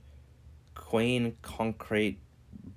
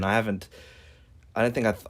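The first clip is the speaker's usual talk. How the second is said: ordinary talk, nothing marked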